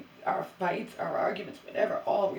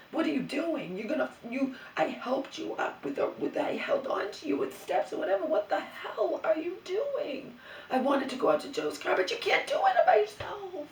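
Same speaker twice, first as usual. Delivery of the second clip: high, louder alarmed scolding of her mother; 10 semitones higher, 3 dB louder than usual. Retold or imitated. Woman scolding her wandering mother, alarmed and loud. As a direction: snap at her mother to stop, pitched high.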